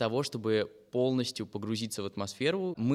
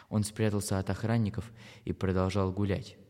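ordinary delivery, nothing marked

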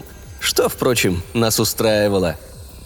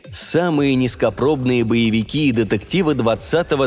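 ordinary delivery

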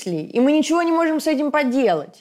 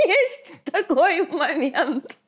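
laughing; 6 semitones higher, much slower than usual. Real, not acted. Laughing remark.

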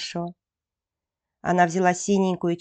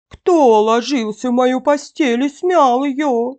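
higher-pitched, loud bear growl; mother-bear growl; 5 semitones higher, 6 dB louder than usual. A narrator's reading. Mother bear finds her bed rumpled, higher growl. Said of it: "зарычала Настасья Петровна не так громко".

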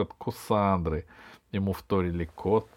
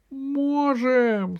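high, squeaky drawn-out gnome reply; tearful squeak; 4 dB louder than usual. Acted. Gnome Веня answers the rally, high tearful squeak. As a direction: pipe up through tears. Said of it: "сквозь слёзы пискнул гном Веня".

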